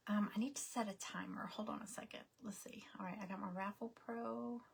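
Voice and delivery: off-mic whisper to herself; hushed aside to herself, not for the viewers. Real, not acted. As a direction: mutter it under your breath while fiddling with the iPad. Aside while setting up the timer.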